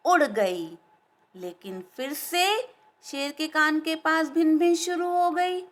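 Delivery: ordinary delivery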